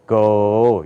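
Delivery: low, stretched "가기구개고" from the throat; American "가기구개고", syllables long and low; American-style syllables. Shown as a demonstration. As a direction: swell each syllable of "가기구개고" open. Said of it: "이렇게 발음된단 말이에요".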